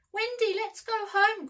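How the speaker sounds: high, loud, anxious plea; frightened cry. A narrator's reading. Boys crying out to Wendy, high and loud. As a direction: cry out in alarm to go home. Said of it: "cried John and Michael".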